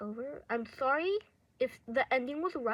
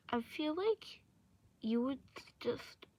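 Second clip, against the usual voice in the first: usual speaking voice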